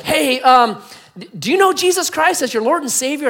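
high, loud, awkward question; hesitant rescuer calling down. Acted. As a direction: call down with a hammed-up hesitation. Rescuer calls down hesitantly to man.

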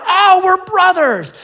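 slow, high, loud mock cheer; ecstatic rescuer. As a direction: draw it out in hammed-up joy. Rescuer rejoices again.

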